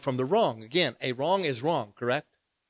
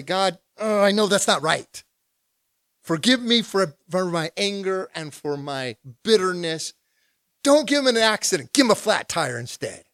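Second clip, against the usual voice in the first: louder, higher half-sorry prayer; mock repentant prayer; 4 semitones higher, 5 dB louder than usual. Pastor playing his own end-of-prayer confession, mock contrite. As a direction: apologise but still hold the grudge. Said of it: "I'll say".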